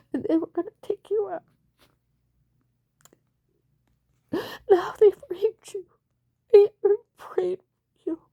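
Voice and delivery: high, loud and tearful; pleading through tears, voice climbing; crying out. Real, not acted. Crying out to John that she prayed for him, high and loud.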